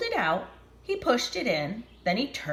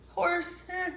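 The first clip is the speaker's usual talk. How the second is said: plain reading voice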